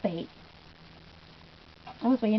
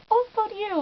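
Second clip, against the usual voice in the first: flustered high reply; 11 semitones higher than usual. Retold or imitated. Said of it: "And I was just like".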